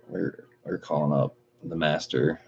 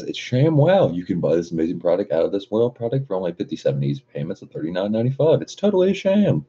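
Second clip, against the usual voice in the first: high, excitable TV sales pitch; hammed-up shopping-channel pitch, swooping high; infomercial pitchman; 3 semitones higher than usual. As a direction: sell it like a fast-talking infomercial host. Reads infomercial parody from chat.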